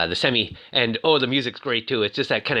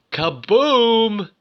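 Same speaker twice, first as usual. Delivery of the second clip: drawn-out excited kaboom; 10 semitones higher, 5 dB louder than usual. Real, not acted. Car explodes down the mountain.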